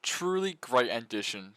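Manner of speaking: ordinary talk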